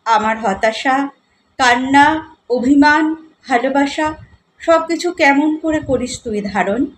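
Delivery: measured poetry reading, phrase by phrase with pauses; poem recitation. Acted. Poet addresses a loved one in her poem, recited expressively.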